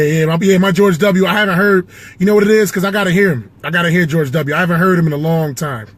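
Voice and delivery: ordinary talk, nothing marked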